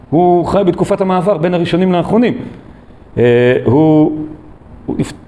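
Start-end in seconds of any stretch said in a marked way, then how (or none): none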